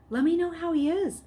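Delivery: put-on boy begging the nurse. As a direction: plead as you leave. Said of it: "Luke begged".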